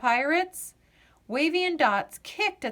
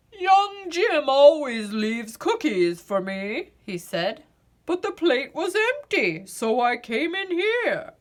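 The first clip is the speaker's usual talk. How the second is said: big, hearty giant's voice, a bit louder; Santa Claus voice; 5 semitones higher, 4 dB louder than usual. A narrator's reading. Santa explaining to the pirates why he came, hearty giant voice. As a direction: play the huge gentle Santa.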